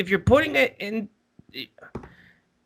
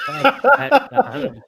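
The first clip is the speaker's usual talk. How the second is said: slow, laughing mock curse, a bit higher and louder; laughing echo; 8 semitones higher, 5 dB louder, slower than usual. Real, not acted. Friend echoing the curse, laughing and higher. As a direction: savour the curse through a laugh. Friend echoes the dig, amused.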